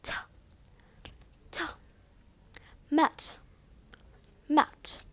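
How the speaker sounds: "t" as a bare puff of air; soft "Mat" ending on a released, airy t. Shown as a demonstration. Teacher drilling standard RP, aspirated T. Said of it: "Just push air out of your mouth".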